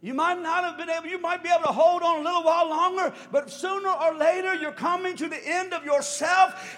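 high, loud, driving preacher build; preaching at full force. Real, not acted. Preacher pressing the crowd toward surrender, full force. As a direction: rise and hammer it home.

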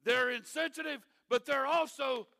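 preaching at full force. Acted. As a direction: drive the point home to the congregation. Pastor preaching the main point.

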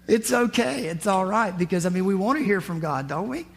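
ordinary talk

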